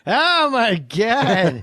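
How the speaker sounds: drawn-out comic impression; Norm Macdonald. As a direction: stretch every word in mock amazement. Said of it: "every time I do Norm Macdonald".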